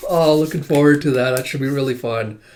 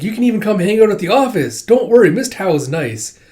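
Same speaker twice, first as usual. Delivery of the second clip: cheerful invite; 7 semitones higher, 4 dB louder than usual. Retold or imitated. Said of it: "It's like".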